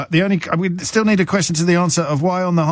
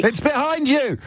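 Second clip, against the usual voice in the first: panto crowd callback; 12 semitones higher than usual. Pretend. Panto callback after mystery noise.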